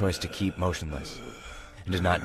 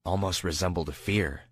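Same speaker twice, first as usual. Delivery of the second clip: usual speaking voice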